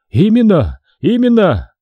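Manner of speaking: high, wailing assent; high-pitched insistent repetition; eager agreement. A narrator's reading. Priest agrees in his lament.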